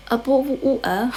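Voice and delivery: British accent with swallowed T's. Shown as a demonstration. Showing viewers the only sentence known in a British accent, British accent. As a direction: drop the T's like a Brit. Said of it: "how to say in British accent".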